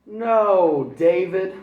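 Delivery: loud, high scolding shout; angry mother's shout; mom shouting. Acted. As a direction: yell it across the room at him.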